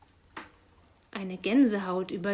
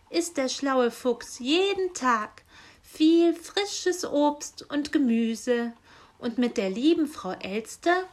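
higher, brighter-pitched angel voice coaxing him round; a young helper angel wheedling Nikolaus, higher and louder; 4 semitones higher, 4 dB louder than usual. A narrator's reading. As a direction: sweet-talk the grumpy old saint.

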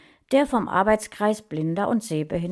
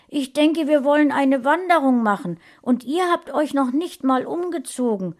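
higher, louder, fast indignant cry; indignant outburst; 8 semitones higher, 5 dB louder than usual. A narrator's reading. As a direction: burst out, offended nobody is ready. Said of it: "rief sie entrüstet".

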